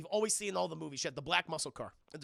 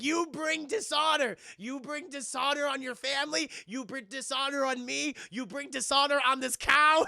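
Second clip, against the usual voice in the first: Mushu-style scolding; 6 semitones higher, 7 dB louder than usual. Retold or imitated. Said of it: "He does the whole fucking Eddie Murphy thing".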